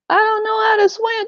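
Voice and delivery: put-on very high, small kid voice; child's voice. Kid on the dock answers the parent, in a very high child voice. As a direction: squeak it out like a scared child. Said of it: "kid's reply in the scene".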